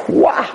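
short high-pitched cry of revulsion; disgusted "wah". Acted. Storyteller reacting to the soiled man's stink, disgusted cry. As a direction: recoil from a stench with a yelp.

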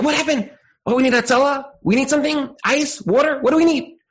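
podniesiony, spanikowany głos rodzica; panicked parent, high and urgent; frantic. Retold or imitated. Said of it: "You're like".

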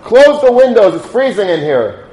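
mock shout, high and loud at first, easing off; retold screaming. Train passenger screaming at the rabbi about the cold, imitated shouting. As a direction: snap out an imitated complaint, punching the opening words. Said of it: "the same person starts screaming".

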